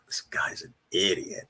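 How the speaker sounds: slow muttered put-down, under the breath; whispered aside. Retold or imitated. The other line whispering about the radio host. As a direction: hiss it like a snide listener on the other line. Imagined caller mocking on line.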